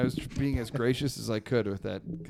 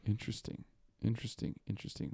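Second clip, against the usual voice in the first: fading quiet musing; 5 semitones lower, 12 dB quieter, much faster than usual. Real, not acted. Trailing repeated musing.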